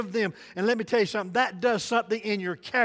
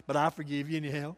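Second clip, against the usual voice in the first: chuckling; 5 semitones lower, 8 dB quieter, much faster than usual. Retold or imitated. Chuckling through a mock apology.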